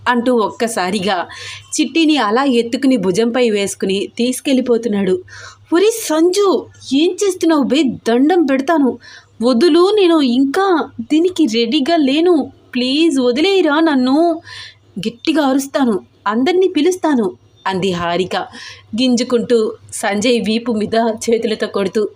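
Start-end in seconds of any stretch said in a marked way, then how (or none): 5.7-17.3 pleading wife in a higher pitch; high, flustered protest to husband; protesting heroine. Acted. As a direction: beg and threaten him, voice pitched up. Heroine protests being carried off.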